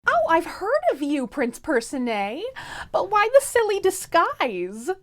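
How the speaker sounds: teasing princess. Acted.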